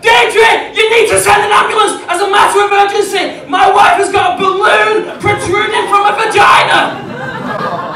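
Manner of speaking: high, loud, urgent yelling down the phone; panicked shout. Retold or imitated. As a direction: shout for help, barely keeping it together. Panicked urgent call to midwife.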